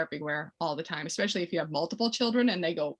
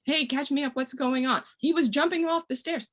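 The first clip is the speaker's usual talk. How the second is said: higher, casual check-in; breezy friendly interjection; 7 semitones higher than usual. Shown as a demonstration. Parent asking the uncle what happened, breezy and higher. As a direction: sound light and curious. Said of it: "you know".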